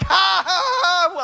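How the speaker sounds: cracking up laughing at the scene in the prayer room; laughing out loud. Retold or imitated. High laughing fit while praying over the lady. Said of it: "I'm like".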